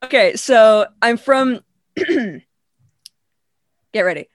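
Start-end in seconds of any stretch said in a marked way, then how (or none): none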